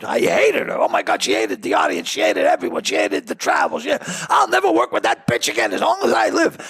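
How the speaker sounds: high, fast-building exasperated rant; agent's rant. Acted. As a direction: rant as the fed-up agent in a joke. Agent's rant in a retold joke.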